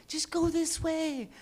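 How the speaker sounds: high, coaxing voice as if to a pet; coaxing owner. Retold or imitated. Coaxing the cat down from the tree in a high, sweet voice. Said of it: "I'm like".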